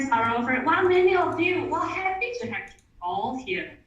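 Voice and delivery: ordinary talk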